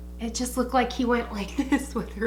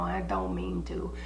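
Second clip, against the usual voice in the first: sheepish whisper; whispered aside; 7 dB quieter than usual. Real, not acted. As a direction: whisper it under your breath. Guilty aside after self-criticism.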